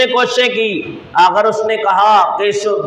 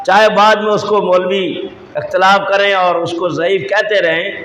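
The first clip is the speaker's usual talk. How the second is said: ordinary talk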